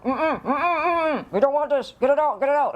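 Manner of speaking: high, humming refusal; protesting stomach. Retold or imitated. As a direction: voice a queasy stomach pleading in a high pitch. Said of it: "my stomach would be like".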